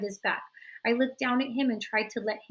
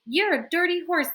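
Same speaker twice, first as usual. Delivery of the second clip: higher, louder accusing cry; cried out; 8 semitones higher, 5 dB louder than usual. A narrator's reading. Children crying out accusations at Horse, loud. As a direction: fling the accusation at him. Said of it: "they cried".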